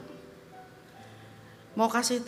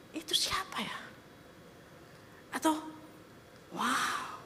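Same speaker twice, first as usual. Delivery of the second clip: hushed whisper, wondering; awed whisper; 3 semitones higher, 11 dB quieter than usual. Acted. Disciples whispering in awe after the calm. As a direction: breathe the question in awe.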